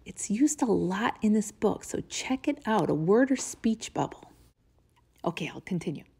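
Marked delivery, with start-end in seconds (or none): none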